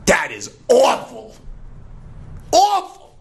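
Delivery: high, loud cry of disgust; disgusted wolf. Acted. Wolf telling the lion his breath is awful, disgusted.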